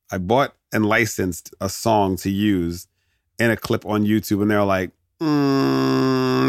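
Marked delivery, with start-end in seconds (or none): 5.2-6.5 long, level, higher-pitched "mmm"; drawn-out mock-official hum of doubt; disapproving hum. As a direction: hum at length before refusing. Acts YouTube rejecting his licensed song.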